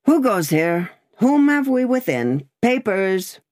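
lightly voiced brusque guard; barrier guard. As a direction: demand papers like a gate guard.

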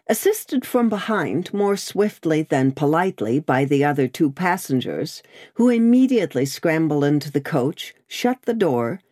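plain reading voice

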